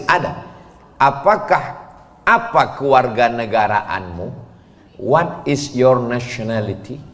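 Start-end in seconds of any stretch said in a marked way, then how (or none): none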